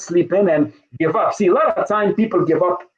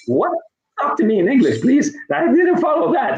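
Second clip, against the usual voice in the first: higher-pitched, mock-bewildered plea; baffled protest; 8 semitones higher than usual. Dad retelling how he begged his son to talk plain English, baffled. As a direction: act out being completely lost, pitch climbing.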